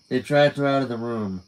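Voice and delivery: plain reading voice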